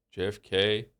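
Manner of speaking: ordinary talk, nothing marked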